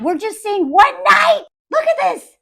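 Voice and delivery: high-pitched outrage; exasperated. Real, not acted. Viewer appalled by the husband's behaviour, high-pitched exasperation. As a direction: rise into appalled disbelief. Outraged at what wife endures.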